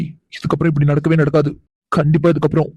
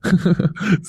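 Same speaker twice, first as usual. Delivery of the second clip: amused chuckle; 3 semitones higher, 4 dB quieter than usual. Acted. Husband laughs at wife.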